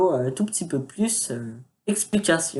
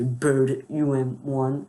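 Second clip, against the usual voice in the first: "Bird U M one" said in an English accent, "one" as wan; a self-conscious English accent; 2 semitones lower, much slower than usual. Shown as a demonstration. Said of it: "Non, mon accent, il est pourri".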